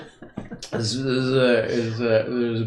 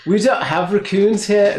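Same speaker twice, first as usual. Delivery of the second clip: put-on higher, louder Kiwi accent; New Zealand accent; 9 semitones higher, 7 dB louder than usual. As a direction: push the Kiwi vowels harder. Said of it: "That's my New, New Zealand accent".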